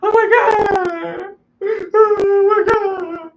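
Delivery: very high, slow, crying falsetto wail; mock a sobbing sister screaming "oh my God"; high wailing impression of his crying sister; hysterical sister. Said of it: "my sister called me crying, hysterical".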